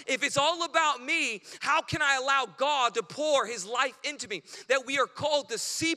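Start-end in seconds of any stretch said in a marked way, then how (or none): none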